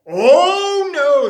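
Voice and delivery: high, loud cry of dismay; a startled wail of oh no. Acted. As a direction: cries out in alarm at the door. Shocked at bunnies on doorstep.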